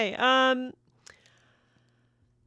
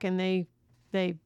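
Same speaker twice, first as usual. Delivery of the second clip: ordinary delivery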